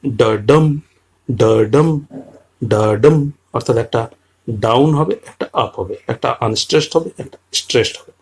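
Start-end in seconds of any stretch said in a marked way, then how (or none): 0.1-3.3 da-DUM chanted with the stress on the second beat; iambic da-DUM beat. Shown as a demonstration. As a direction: tap out the iambic rhythm, weak then strong. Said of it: "এটার যে ছন্দ লয়টা কেমন হবে?".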